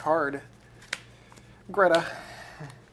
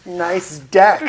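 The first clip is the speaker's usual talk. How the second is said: louder mocking repeat of Nice deck; sarcastic echo; 3 semitones higher, 11 dB louder than usual. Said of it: "I said that sincerely".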